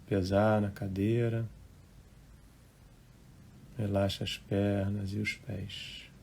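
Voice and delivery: unhurried, hushed relaxation guidance; guided meditation. Real, not acted. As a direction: gently guide listeners into relaxing, phrase by phrase.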